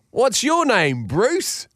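high-pitched, hammed-up Australian; bad Aussie accent. Boss following up his joke on the Aussies, bad Aussie accent. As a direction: tease in a terrible Aussie accent. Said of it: "all in a pretty shitty Aussie accent".

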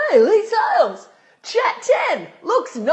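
put-on loud, high drunk heckle; tipsy stranger. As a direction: call out like a tipsy guy in the street. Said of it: "a guy who seemed like he'd had a few drinks".

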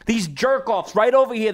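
ordinary talk

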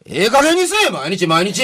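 high, loud scolding yell; angry shout. Retold or imitated. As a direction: bellow it from behind the door. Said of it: "っていう大声が聞こえた".

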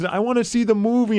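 imitated high, eager child; eager kid. Imitates excited kid begging.

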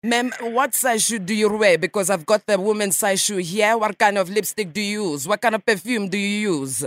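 a light mock Russian accent, nasal ma'am; radio prank caller in a lightly faked Russian accent; put-on Russian accent. Prankster calls a stranger as a Russian journalist, mock Russian accent. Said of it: "for a Russian, your English is quite good".

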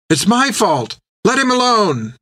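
high, rising desperate cry; Finn crying out. A narrator's reading. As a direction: cry out to take the blame for a friend. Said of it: "Finn cried".

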